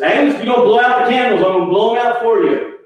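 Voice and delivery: fast, high mock threat; impatient prodding. Harry prods Agnes over the candles, quick high impatience. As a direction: imitate an impatient host hurrying her up.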